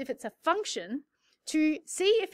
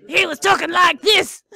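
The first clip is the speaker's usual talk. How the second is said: loud, raised put-on voice; imitated character voice; 5 semitones higher, 10 dB louder than usual. Teacher imitating the man who changed hats, loud character voice. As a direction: mimic him in a big, higher voice. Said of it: "he was a different person".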